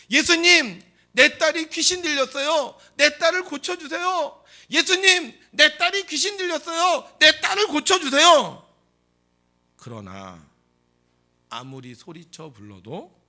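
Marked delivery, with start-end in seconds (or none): 0.1-8.7 very high, full-force shouted plea; 더 크게 소리침. Acted. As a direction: scream the plea at the top of your voice. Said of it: "더 크게 소리칩니다". (목청껏 높이 부르짖기)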